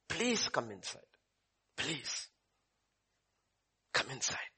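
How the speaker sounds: soft, hushed tender plea; pleading softly. Acted. Father softly begs angry son to come inside. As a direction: gently beg your son to come in. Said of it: "his father pleaded with him".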